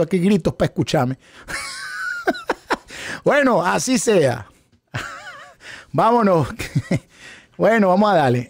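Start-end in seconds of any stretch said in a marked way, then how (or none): 1.5-3.2 high laugh. Real, not acted. Laughs at his shouting. 4.9-7.0 laughing. Real, not acted. Laughing moving on.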